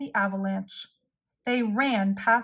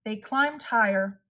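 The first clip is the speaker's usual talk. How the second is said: usual speaking voice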